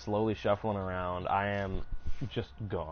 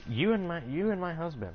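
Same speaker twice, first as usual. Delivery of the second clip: lightly voiced older coworker, higher; a hint of her accent, pitched up; coworker, lightly mimicked, higher; older Puerto Rican woman; 8 semitones higher than usual. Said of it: "she's like".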